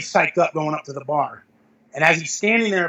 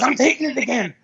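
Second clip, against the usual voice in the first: higher-pitched defiant quote; retold insistence; 8 semitones higher than usual. Worn-out lifter to worried onlookers, retold defiant insistence. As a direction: imitate a lifter stubbornly insisting.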